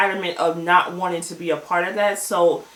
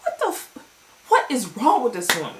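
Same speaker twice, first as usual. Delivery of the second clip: shocked exclamation; 5 semitones higher than usual. Real, not acted. Shocked by table flip.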